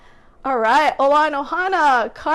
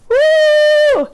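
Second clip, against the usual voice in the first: excited cheer; 9 semitones higher, 10 dB louder than usual. Real, not acted. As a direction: cheer her on at full voice. Cheering birthday girl's shout-out.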